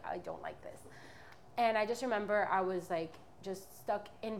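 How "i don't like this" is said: hushed, breathless whisper of dread; retold whisper. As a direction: whisper it, almost no voice.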